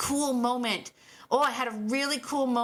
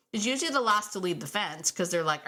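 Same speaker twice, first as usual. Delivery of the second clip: ordinary talk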